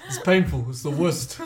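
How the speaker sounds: a hint of blustering politician; Donald Trump. Writer rereading their birth story, light Trump impression. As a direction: lightly mimic a boastful politician, amused. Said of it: "like Donald Trump".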